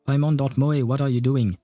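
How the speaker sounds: ordinary talk, nothing marked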